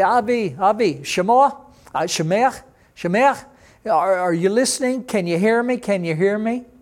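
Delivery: mock dispatcher checking if anyone is listening; dispatcher calling. Taxi dispatcher calling to a driver, imitated calling. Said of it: "dispatch desk calling to the taxi".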